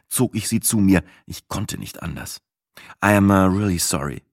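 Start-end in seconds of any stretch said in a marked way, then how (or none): none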